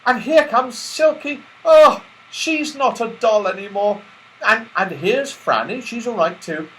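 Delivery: high, loud joyful relief; delighted Beth. A narrator's reading. Beth finding she is no longer a doll, overjoyed. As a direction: gush with happy relief.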